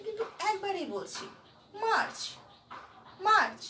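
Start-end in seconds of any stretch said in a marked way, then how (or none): 1.8-3.6 March said loud and short, in one beat. Shown as a demonstration. Teacher models March as one beat for the class. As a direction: punch March out at once, no pause. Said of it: "এখানে কোথাও আমি থামছি না".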